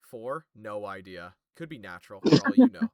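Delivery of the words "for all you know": teasing. Real, not acted. GM teasing players.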